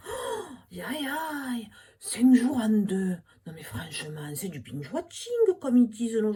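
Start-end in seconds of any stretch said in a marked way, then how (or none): 0.1-3.2 high, comic aïe aïe aïe; hammed-up dismay at the news; mock dismay. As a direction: fake alarm, then slow and loud.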